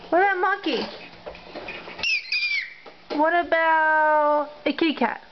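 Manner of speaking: normal talking voice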